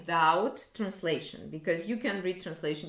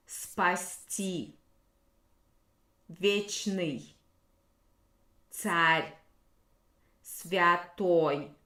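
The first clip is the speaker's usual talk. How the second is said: each word read slowly and deliberately, with pauses; slow vocabulary reading; much slower than usual. Shown as a demonstration. Teacher slowly reading vocabulary words to the class. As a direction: read the list out word by word for the students. Reads the vocabulary list again.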